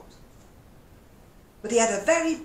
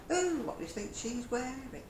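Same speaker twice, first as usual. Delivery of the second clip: mock gossip; 6 semitones higher than usual.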